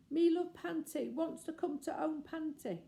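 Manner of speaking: put-on high, soft loving toddler voice; baby voice. The baby lamb calling to Panty, high and loving. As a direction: coo it like a small child. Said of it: "soft little loving voice of the baby".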